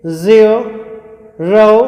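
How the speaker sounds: ज़ीरो very slowly as zia, rau; word in parts. Shown as a demonstration. Teacher showing how it is pronounced, slow zia, rau. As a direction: stretch ज़ीरो into two gliding halves. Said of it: "इस तरीके से होगा इसका pronunciation".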